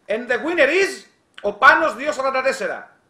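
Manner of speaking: playful announcer voice; winner announcement. Acted. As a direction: announce it like a host.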